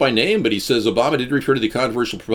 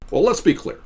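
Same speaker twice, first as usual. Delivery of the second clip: lightly imitating Obama; a hint of a presidential pause; Obama impression; 7 semitones higher than usual. Obama catchphrase mocked.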